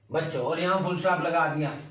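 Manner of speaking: ordinary talk, nothing marked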